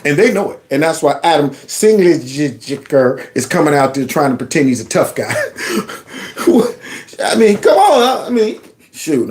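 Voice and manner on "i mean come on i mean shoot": laughing. Real, not acted. Laughing at tough guy.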